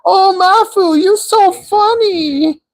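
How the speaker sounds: very high, slow mock Chinese accent. Streamer imitating a girl in a high mock Chinese accent. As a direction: drag out the tease in a squeaky Chinese accent. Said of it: "why am I doing a Chinese accent".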